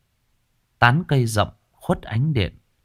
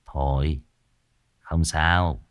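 calm measured; 5 semitones lower, slower than usual. A narrator's reading. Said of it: "hắng giọng từ tốn".

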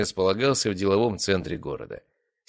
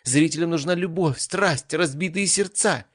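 excited exclaim; 10 semitones higher than usual. Acted. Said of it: "воскликнула одна из сценаристок".

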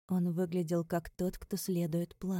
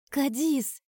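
raised, loud demand by name; insistent call; 7 semitones higher, 6 dB louder than usual. Acted. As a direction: call out sharply for an answer. Demanding an answer from evasive friend.

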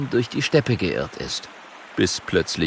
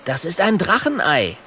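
Kokosnuss's voice; 8 semitones higher than usual. Acted. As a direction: apologise high like a little dragon.

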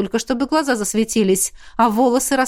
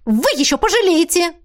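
high-pitched indignant threat; affronted warning; angry threat; 7 semitones higher, 3 dB louder than usual. A narrator's reading. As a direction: threaten him, voice raised in pitch. Woman threatens rector in a quarrel.

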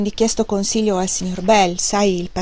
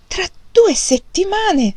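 much higher, slow, stunned cry; shocked exclamation; 11 semitones higher, 4 dB louder, slower than usual. A narrator's reading. Mother hearing news of the move, shocked slow cry. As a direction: let the shock stretch each word out. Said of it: "esclamò la signora Hale".

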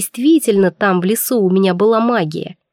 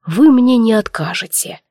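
ordinary delivery

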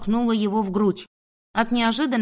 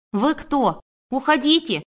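higher-pitched alarmed cry, moderately raised; cried out; 4 semitones higher than usual. A narrator's reading. Woman backing away from the intruder, crying out. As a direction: call it out in alarm. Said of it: "пятясь выкрикнула я".

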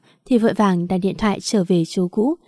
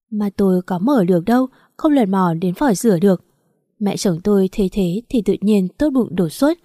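usual speaking voice

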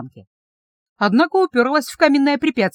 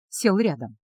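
ordinary delivery, nothing marked

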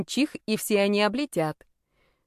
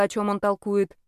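ordinary delivery, nothing marked

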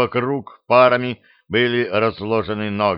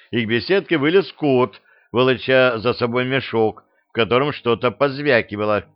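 usual speaking voice

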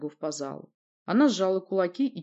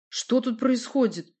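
higher, slow, puzzled question; surprised question; 6 semitones higher, 4 dB louder, slower than usual. A narrator's reading. Newcomer asks the prince what goes on, surprised. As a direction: ask it slowly in astonishment. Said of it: "не менее удивлённо спросил я".

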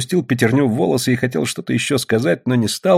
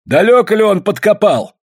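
high, loud demanding question; agitated shout; 12 semitones higher, 5 dB louder than usual. A narrator's reading. Prospector disputing the digging, agitated high shout. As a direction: demand it loudly, pitched high. Old man's frenzied argument continues.

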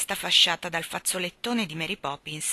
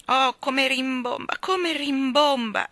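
slow, high, drawn-out moan; pained wail; 7 semitones higher, 5 dB louder, slower than usual. A narrator's reading. Jane holding her aching ear, pained wail. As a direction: wail it loudly, stretching each word.

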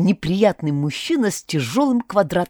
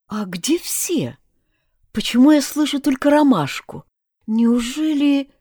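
anxious whisper; 6 semitones higher than usual. A narrator's reading. Heroine fearful in dark cellar.

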